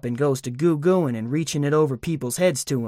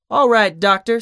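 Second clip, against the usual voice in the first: mocking, higher and louder; king taunting the departing doctor; 7 semitones higher, 6 dB louder than usual. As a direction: sing it out with a mocking sneer. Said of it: "kinda mocking him".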